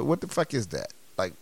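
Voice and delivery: ordinary talk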